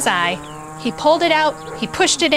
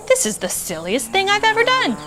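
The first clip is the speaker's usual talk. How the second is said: lightly voiced cow thinking; 10 semitones higher than usual. A narrator's reading. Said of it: "what she thought was".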